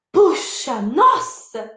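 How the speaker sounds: amazed exclamation with a swooping tune; acted wonder; astonished. Shown as a demonstration. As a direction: marvel at it in surprise. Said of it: "E tem também de espanto".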